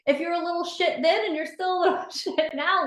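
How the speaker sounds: high, giggling through the joke; laughing tease. Real, not acted. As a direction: laugh as you tease her. Teasing a member playfully.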